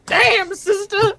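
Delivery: high, slow sassy drawl; sassy exclamation. Retold or imitated. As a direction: stretch it out like a diva. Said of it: "you're like".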